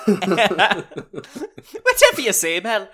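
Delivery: laughing loudly; loud laugh through words. Real, not acted.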